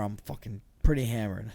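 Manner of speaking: ordinary delivery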